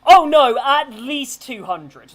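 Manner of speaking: loud, slow emphatic answer. Real, not acted. GM stressing how many prisoners, loud and slow. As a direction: lean hard on the number.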